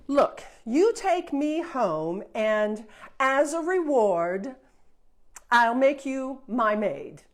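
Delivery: loud, slow, commanding princess; bossy princess. Acted. Spoiled princess ordering the fairy, loud and bossy. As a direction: order her about like a servant.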